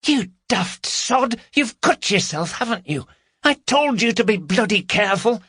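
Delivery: high-pitched, scolding woman's character voice; scolding burglar. A narrator's reading. Carol to injured accomplice at the break-in, scolding. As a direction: tell off your accomplice in a high voice. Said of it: "they could be heard arguing".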